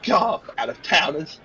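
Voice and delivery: irritated barmaid's mutter; exasperated muttering about customers; grumbling to herself. Acted. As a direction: complain under the breath, rising in pitch at the end. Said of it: "muttering to herself".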